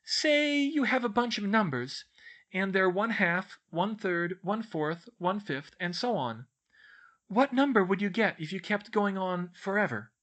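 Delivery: put-on bookish girl explaining. A narrator's reading. Girl explains her maths book to friends, earnest. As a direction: explain in a high, earnest schoolgirl voice. Voices Hermione in story.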